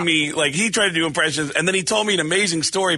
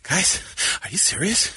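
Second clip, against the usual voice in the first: panting Wahlberg; out of breath Mark Wahlberg. Pretend. A breathless Wahlberg begging the guys for help. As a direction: gasp it out like you just ran. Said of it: "Out of breath Mark Wahlberg".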